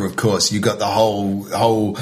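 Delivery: usual speaking voice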